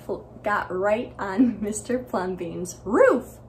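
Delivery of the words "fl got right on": ordinary delivery, nothing marked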